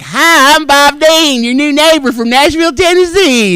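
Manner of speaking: high, loud, sing-song hello; hammed-up cheerful new neighbour; mock cheery greeting. His cheery self-introduction.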